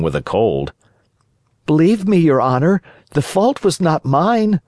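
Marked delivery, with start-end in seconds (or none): 1.6-4.6 high-pitched pleading little voice; pleading puppet. A narrator's reading. Pinocchio defends himself to the director, pleading. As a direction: plead your innocence in a high voice.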